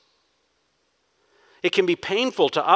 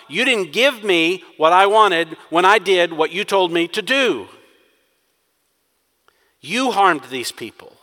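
selfish complaint; 4 semitones higher, 6 dB louder than usual. Acted. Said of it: "This should be celebration time".

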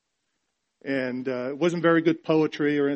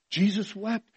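higher, questioning lift at the end; astonished; 6 semitones higher than usual. Real, not acted. Preacher marvelling to congregation at Christ's tears, astonished. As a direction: repeat it in wonder, pitched up.

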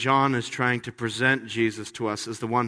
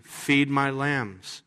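slow reading; much slower than usual. Acted. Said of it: "Verse fifteen".

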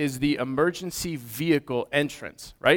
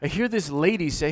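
ordinary delivery

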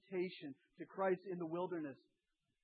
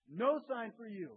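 No sign for you, stern and louder; mock refusal; 5 semitones higher, 6 dB louder than usual. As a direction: hand down the verdict. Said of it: "You got it".

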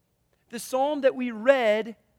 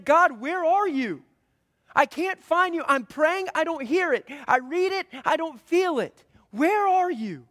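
higher, louder desperate plea to God; frustrated pleading; 6 semitones higher, 5 dB louder than usual. Acted. Preacher voicing a lost believer, pleading loud and high. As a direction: cry out to God, close to despair.

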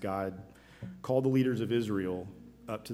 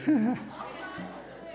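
breathless laugh. Real, not acted. Preacher laughs catching breath.

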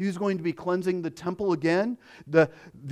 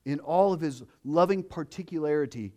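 ordinary delivery

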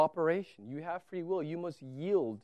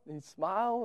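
normal talking voice

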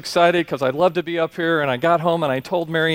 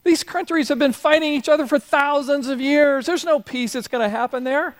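much higher, exasperated mock genie voice; hammed-up annoyed genie, pitched way up; ticked-off genie; 10 semitones higher than usual. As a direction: play the fed-up genie throwing up his hands. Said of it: "he gets all ticked off".

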